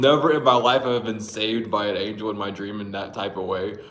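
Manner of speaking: ordinary talk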